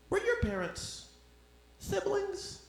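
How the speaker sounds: high put-on questioning voice; mocking stranger. Comic mimics a stranger asking about his parents, high and mocking.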